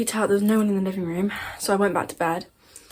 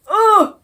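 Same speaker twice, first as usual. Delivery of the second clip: loud, high-pitched "ugh" of revulsion; shuddering "ugh"; 13 semitones higher, 13 dB louder than usual. Real, not acted. Storyteller recoils from her own creepy imitation, shuddering. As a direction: let out a creeped-out yelp.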